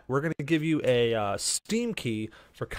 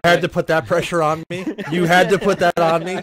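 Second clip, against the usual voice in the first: loud, playful protest; mock outrage; 3 semitones higher, 7 dB louder than usual. Real, not acted. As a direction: complain loudly, half joking. Playfully complaining about mom watching.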